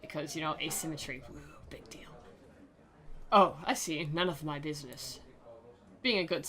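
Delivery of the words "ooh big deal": hushed, whispered mock awe. Streamer making fun of asymmetry in anime shirts, mock whisper. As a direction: whisper it as if impressed.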